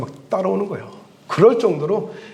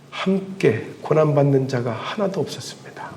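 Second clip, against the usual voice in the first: hushed, softer whisper; solemn whisper; 5 semitones lower, 7 dB quieter than usual. Real, not acted. Preacher drops to solemn hush. (설교 중 아무도 없었다는 대목을 속삭이듯)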